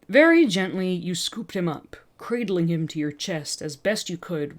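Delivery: plain reading voice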